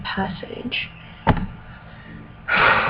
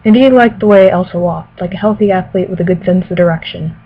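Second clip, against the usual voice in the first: louder, careful novel reading voice; story narration; 5 dB louder than usual. Acted. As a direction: read it like an audiobook. Reads novel passage aloud.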